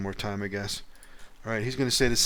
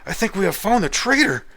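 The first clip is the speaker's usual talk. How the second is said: higher, louder horrified cry; with horror; 10 semitones higher, 10 dB louder than usual. Acted. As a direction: gasp out the discovery in horror. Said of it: "Say with horror on your face".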